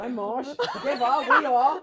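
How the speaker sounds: loud, high team announcement with laughter; cheesy, shouted group catchphrase; corny group introduction. Acted. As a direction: belt it out like a girl-group intro. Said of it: "So corny was like".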